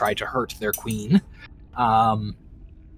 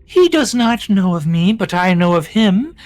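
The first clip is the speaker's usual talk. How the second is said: queen voice; 5 semitones higher, 4 dB louder than usual. Acted. Queen speaking in scene.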